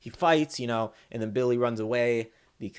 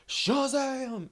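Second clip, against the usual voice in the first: mock battle cry, pitched way up; imitated hero yell. Hero leaping off the roof, imitated high yell. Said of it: "he got the yell right".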